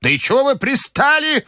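put-on high, exasperated old-lady cry; high-pitched irritation; shouting grandmother. A narrator's reading. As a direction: cry out crossly at pestering company. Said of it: "закричала бабушка".

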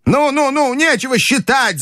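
put-on very high old-lady urging; high, imperious impatience; impatient grandmother. A narrator's reading. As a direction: cut him off in a high voice. Grandmother waves off the counting.

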